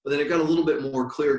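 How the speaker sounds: ordinary delivery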